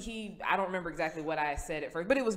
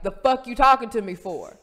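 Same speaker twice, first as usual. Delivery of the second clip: loud, higher, confrontational retort; louder repeat; 4 semitones higher, 10 dB louder than usual. Retold or imitated. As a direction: throw it back over the noise. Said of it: "because it was loud in the place".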